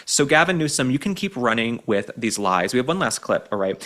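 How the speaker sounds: normal talking voice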